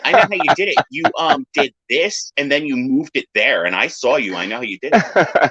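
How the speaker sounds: put-on high, whiny know-it-all child; imitating a bratty kid. A kid taunting the magician, in a high whiny voice. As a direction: play a smug little kid lightly. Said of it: "they're like".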